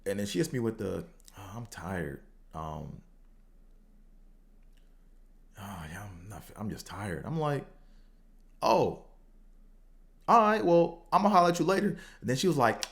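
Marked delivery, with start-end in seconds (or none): 1.4-7.2 soft, weary, much quieter mumble; tired brush-off. Retold or imitated. Friend imitated turning down a late plan, tired and soft. 8.6-12.0 higher, louder sarcastic okay-fine; annoyed sarcasm. Retold or imitated. Him mocking his own huffy goodbye to the tired friend. Annoyed reply to her excuse.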